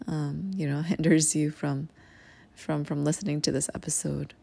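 ordinary talk

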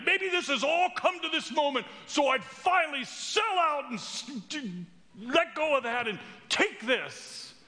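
rising to a high, almost shouted eagerness; eager excitement. Retold or imitated. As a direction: talk yourself into it, thrilled. Eager inner voice deciding.

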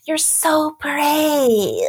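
high, drawn-out, condescending Karen coo. Retold or imitated. Audience member turns to the performer after the show, Karen voice. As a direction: slowly stretch it out in mock-sympathetic high pitch. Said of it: "in that Karen voice".

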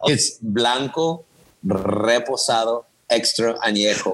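"Blanco, Reposado, Extra Añejo" in careful slow Spanish, rolled r and ñ; Spanish pronunciation. Shown as a demonstration. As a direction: say "Reposado" with a tapped Spanish r, slowly. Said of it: "say it appropriately so people know".

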